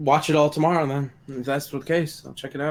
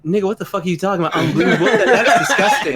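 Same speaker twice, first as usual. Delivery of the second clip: outraged retort; 5 semitones higher, 6 dB louder than usual. Retold or imitated. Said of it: "And I was like".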